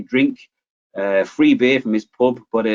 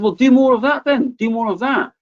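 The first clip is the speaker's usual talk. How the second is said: urging; 12 semitones higher than usual. Retold or imitated. Son urging dad.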